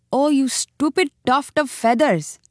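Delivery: a touch of angry mongoose. A narrator's reading. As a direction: scold the foolish bird. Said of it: "said Rikki Tikki angrily".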